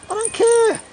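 very high squawked outburst; comic high yelp of frustration; exasperated outburst. Pretend. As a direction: burst out in sudden high-pitched impatience. Outburst breaking off muddled maths.

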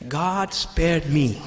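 God spared me, slow and heartfelt; slow sincere confession. Shown as a demonstration. Preacher speaking for Christians, slow and sincere. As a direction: let it land slowly as personal thanks. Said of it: "we look at it this way".

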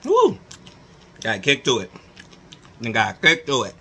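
eating chips between words; talking while chewing. Real, not acted. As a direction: mouth partly full. Seen 0: Bites chip at -5; lips pursed chewing, holding chip while talking.

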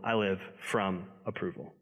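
soft low close on "from approval"; quiet emphasis. Acted. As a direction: let it sink quietly. Quote contrast for vs from.

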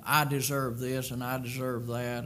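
usual speaking voice

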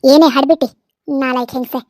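low-pitched insult, loud at the start; low, menacing scolding; contemptuous anger. Acted. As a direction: confront her with scorn. Mother-in-law turns to insulting her.

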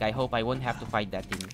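ordinary delivery

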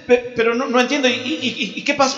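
high, halting confusion; flustered stammer. Acted. Angel questioning an exasperated God, flustered stammering. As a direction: stumble over each phrase, acting bewildered.

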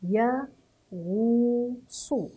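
"يا، غو، صو" separated and drawn out; syllable by syllable. Shown as a demonstration. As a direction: sound out "يا، غو، صو" with a long ghuu. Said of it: "هذا هو نطق حرف الغين مع الضم". (تهجئة "يا، غو، صو" مع ضم الغين)

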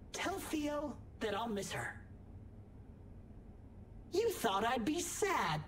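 ordinary talk, nothing marked

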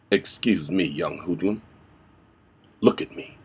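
low, hushed threat sinking into whisper; quiet menace. Shown as a demonstration. Vigilante cornering a young hoodlum, hushed menace. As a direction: intimidate softly, never raising the voice. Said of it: "And of course there's".

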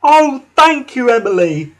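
high, loud grateful whistle of a voice; Whiff. A narrator's reading. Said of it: "whistled Whiff".

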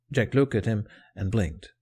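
plain reading voice

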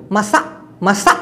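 মাসা ending in a faint glottal catch; Malay masak with clipped k. Shown as a demonstration. Teacher correcting masak to the Malay মাসা. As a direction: cut মাসা short, no hard k. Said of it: "হ্যাঁ করে একটা খোট দিতে হবে".

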